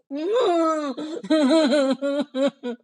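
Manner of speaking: high, acted boo-hoo sobbing; mock wailing sobs in a storybook style; loud crying. A narrator's reading. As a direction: cry out loud like an upset princess. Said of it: "she started crying loudly".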